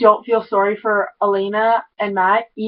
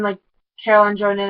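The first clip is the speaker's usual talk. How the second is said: ordinary talk, nothing marked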